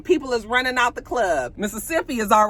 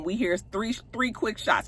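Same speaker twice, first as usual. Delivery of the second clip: usual speaking voice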